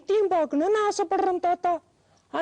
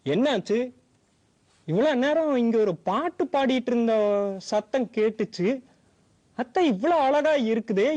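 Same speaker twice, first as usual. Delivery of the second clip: a much lower, gentle grandpa voice; old grandfather coaxing a crying granddaughter; kind old grandfather; 6 semitones lower than usual. Acted.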